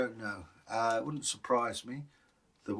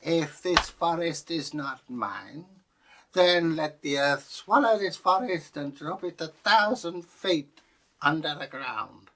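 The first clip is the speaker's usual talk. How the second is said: lofty, boastful proclamation, slow start then louder; the cocky owner daring the earth to swallow the forest, grandly boastful; cocky landowner; 4 semitones higher, 4 dB louder than usual. Retold or imitated. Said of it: "got a bit cocky and said".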